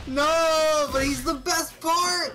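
high, loud outcry; loud protest. Real, not acted. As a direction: shout it in protest. Protesting a teammate's joke.

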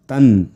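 तन् ending in a clear n sound; anusvara as n. Shown as a demonstration. Teacher shows correct anusvara, तन् ending on n. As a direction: say तन् firmly, closing on n. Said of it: "असा उच्चार आला पाहिजे".

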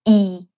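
ای said with the mouth wide and spread; open spread i. Shown as a demonstration. (ای با دهان باز و کشیده)

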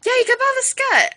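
giddy. Acted. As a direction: blurt it out in a burst of glee. Sudden giddy agreement.

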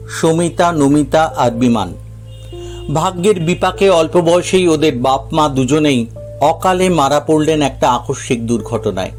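ordinary delivery

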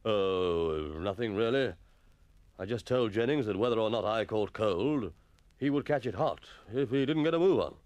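slightly sheepish repeating of a weak quip; mildly diffident. A narrator's reading. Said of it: "felt rather diffident about repeating".

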